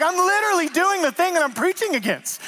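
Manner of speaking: hammed-up whiny preacher; exasperated self-mock. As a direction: whine it like caught in the act. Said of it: "And I was like".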